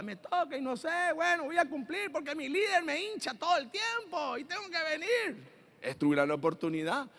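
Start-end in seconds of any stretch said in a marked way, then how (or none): 0.0-5.4 high, soft mock whine; grudging complainer. Member nagged by the leader to come, high mock whine.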